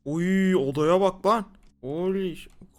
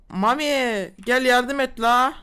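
slow, raised, louder plea; calling for help; 5 semitones higher, 5 dB louder, much slower than usual. Real, not acted. Stuck player calling Mami to come and help, drawn-out plea. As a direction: drag out a loud call for help.

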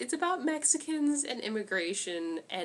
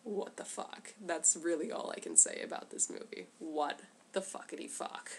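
quiet disbelief; 3 semitones lower, 8 dB quieter than usual. Real, not acted. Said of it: "stunned sign-off".

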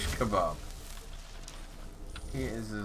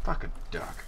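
breathless whispered swear; whispered curse; 10 semitones lower, 9 dB quieter, faster than usual. Real, not acted. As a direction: mutter it under breath. Aside after death.

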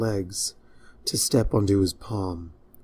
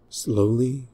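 ordinary delivery

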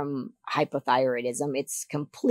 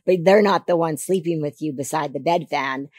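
ordinary delivery

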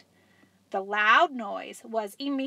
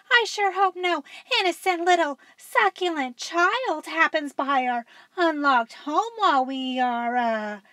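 sly Mama Dinosaur; 6 semitones higher than usual. Acted. Mama Dinosaur voiced in story.

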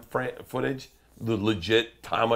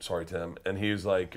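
ordinary talk

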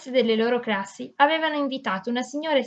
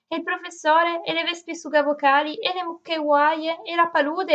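higher, tumbling eager list; excited listing; 6 semitones higher than usual. A narrator's reading. Anna lists the adventure to Zeno, higher and thrilled. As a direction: pile up each memory with growing delight.

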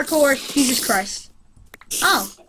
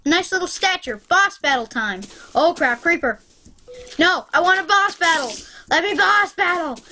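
ordinary talk

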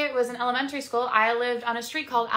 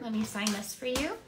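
ordinary talk

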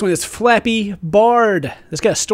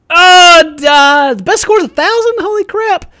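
very high, loud disbelief; astonished outburst; 13 semitones higher, 10 dB louder than usual. Real, not acted. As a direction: exclaim in squealing amazement at the score. Astonished by the best score.

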